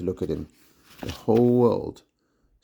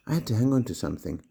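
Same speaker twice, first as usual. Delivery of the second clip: ordinary delivery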